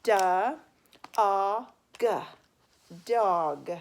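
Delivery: "duh", "ah", "g" split apart loud and slow, then "dog"; sounding out dog. Shown as a demonstration. Teacher sounding out "dog" letter by letter for pupils, loud and slow. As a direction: sound out each letter loudly and slowly. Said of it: "how to spell dog".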